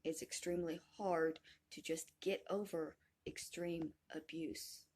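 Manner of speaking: ordinary delivery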